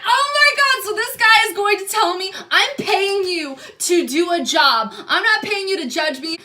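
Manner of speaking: outraged. Retold or imitated. Telling the tech she's paying him, near-crying outrage. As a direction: cry out in disbelief at the insult.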